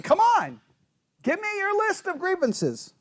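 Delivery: high-pitched demand for an answer; challenge. Acted. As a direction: egg them on insistently. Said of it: "is he saying".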